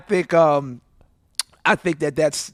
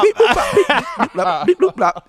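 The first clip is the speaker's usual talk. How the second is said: alien beeps; 6 semitones higher than usual. Pretend.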